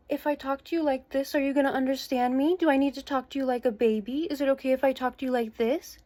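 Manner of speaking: put-on sing-song condescending baby voice; imitating baby voice. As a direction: mimic her taunting baby talk. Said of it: "she switched from yelling to a baby voice".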